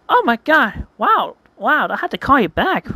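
high, emphatic gasping-news voice; shocked friend. Acted. Rosie on the phone after overhearing gossip, shocked. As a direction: raise the pitch and punch each exclamation. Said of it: "Rose Shu says".

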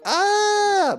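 shrill, loud imitation "ah"; mock high-pitched shriek; high-pitched scream. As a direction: squeal it as high as you can. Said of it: "with the most high-pitched voice".

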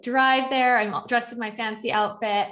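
normal talking voice

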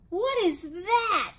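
high, slow, astonished cry. A narrator's reading. Bridget spotting the mail truck, crying out. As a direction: stretch it out high in amazement. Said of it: "cried Bridget".

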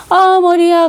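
high, drawn-out mock groan; whiny complaint.

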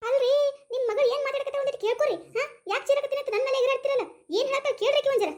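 high-pitched aggrieved protest; indignant complaint. Acted. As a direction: complain hotly about the daughter. Mother angrily complains to husband.

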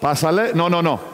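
usual speaking voice

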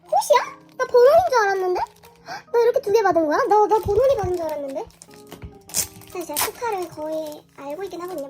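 ordinary talk

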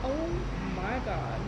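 speaking between bites; talking while chewing. Real, not acted. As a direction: mouth partly full of food. Seen 0: Fork enters mouth at -4; jaw still working while speaking.

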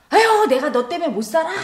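put-on exasperated mother yelling at her child; mock mother's yell. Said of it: "이렇게 소리 지르고".

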